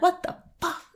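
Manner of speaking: high, hushed, baffled outburst; squeaky-high, softly voiced bewilderment; mock disbelief. Acts out baffled exerciser.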